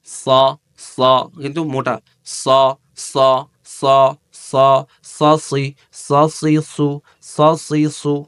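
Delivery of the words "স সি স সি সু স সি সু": crisp S drill syllables স, সি, সু with thick tongue; tajweed letter drill; practice syllables. Shown as a demonstration. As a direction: repeat স সি সু slowly as a drill. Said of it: "এইভাবে মস্ক করবেন".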